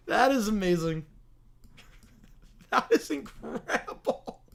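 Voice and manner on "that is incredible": breathless wheezing laugh through the words. Real, not acted. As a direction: laugh it out helplessly. Laughing at story.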